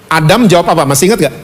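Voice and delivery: normal talking voice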